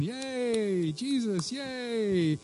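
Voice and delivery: high, drawn-out happy cheering; mock cheer. Shown as a demonstration. Preacher shows congregation the wrong cry, mock cheer. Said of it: "that wasn't krazo".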